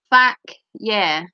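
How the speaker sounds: ordinary delivery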